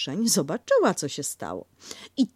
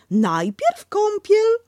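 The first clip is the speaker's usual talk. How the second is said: much higher, louder and slow, keen mother; eagerly; 9 dB louder, much slower than usual. Acted. Kanga to baby about the bath, eager and much higher. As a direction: Kanga relishing bath time. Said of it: "powiedziała ochoczo".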